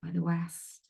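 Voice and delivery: calm meditation guide with long pauses; spacious guided-meditation voice, leaving silence between phrases. Real, not acted. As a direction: ease them into stillness, phrase by phrase. Leads a meditation.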